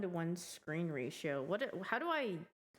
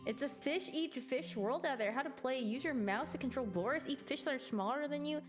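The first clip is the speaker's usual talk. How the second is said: upbeat narrator read-along; game narration read; 6 semitones higher than usual. Acted. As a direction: read it like a cheerful game intro. Reads game narration aloud.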